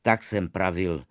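ordinary delivery

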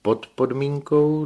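put-on high, slow and loud old man; Filoxen's voice. A narrator's reading. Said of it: "dodal Filoxen". (vysoký, hlasitý stařecký hlas)

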